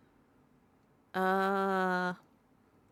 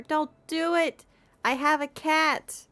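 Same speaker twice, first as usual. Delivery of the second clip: higher, louder, slow plea; playful pleading; 8 semitones higher, 6 dB louder, slower than usual. Real, not acted. Streamer begging chat not to set her more exercise, high and slow. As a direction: beg the chat not to make you exercise, drawn out.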